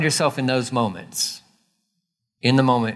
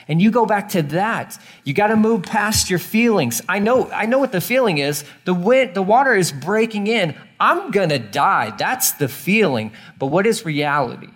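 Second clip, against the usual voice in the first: higher, fervent preaching; preaching at full force; 6 semitones higher than usual. Real, not acted. Preacher urging the congregation past their fear, fervent. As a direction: press them past their feelings.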